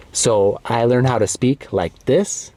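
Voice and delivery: "is how to speak like this" said slowly and clearly. Shown as a demonstration. Teacher shows learners his clear, slow speaking voice. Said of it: "clear, slow".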